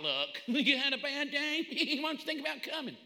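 high, chuckling, no-pressure coaxing; optional invitation. Acted. Preacher mocking what the word isn't, laughing casual invitation. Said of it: "It's not".

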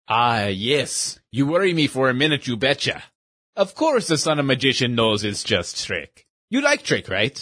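lightly voiced old shopkeeper with a broken-English accent; a kindly old immigrant magician in broken English. Shopkeeper relieved, friendly.

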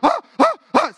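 three loud high gasps; retold panicky gasping; startled gasps. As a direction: gasp sharply in mock fright. Acting out his frightened gasps.

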